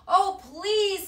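high, loud, frantic cry of a frightened mother; desperate plea. Acted. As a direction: beg for help at the top of your voice. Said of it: "said his mother".

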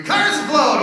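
excited shout. Pretend. Acted action movie excitement.

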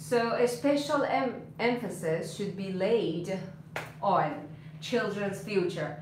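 slow model reading with pauses; slow dictation. Shown as a demonstration. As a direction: read it out in slow pieces for learners. Teacher repeats model sentence.

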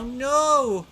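loud, high cry of dismay; frustrated no. Real, not acted. Player loses his drone, loud dismayed cry. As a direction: yell at the lost drone.

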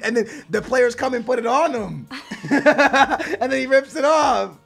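high, laughing retelling; cracking up. Real, not acted. Player retelling a funny Instagram video, cracking up. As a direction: tell it through loud bursts of laughter.

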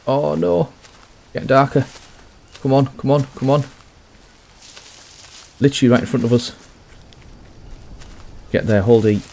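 high, urgent panicked pleading; rushing, scared it won't make it. Real, not acted. Racing darkness to reach village.

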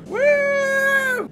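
long, high-pitched "woo!"; high whoop. Real, not acted. Player setting off in the game, high whoop. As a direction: let out a gleeful high whoop.

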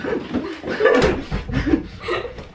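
high, breathless wheeze of laughter. Real, not acted. Laughing at chewing the wrapper.

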